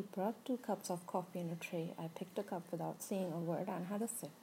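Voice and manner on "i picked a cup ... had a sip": ordinary delivery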